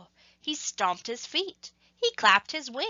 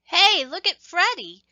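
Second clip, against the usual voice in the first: higher and louder, calling across the ice; called out; 8 semitones higher, 7 dB louder than usual. Acted. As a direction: call the others over to look. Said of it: "one of the penguins called out".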